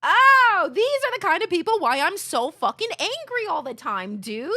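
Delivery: loud, high frustrated rant; loud fed-up rant; angry outburst. Real, not acted. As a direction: vent it loudly.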